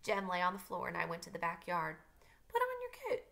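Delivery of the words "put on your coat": high, airy, dreamy put-on voice; badly faked dreamy father, pitched way up; Atticus, dreamy. A narrator's reading. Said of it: "said Atticus dreamily".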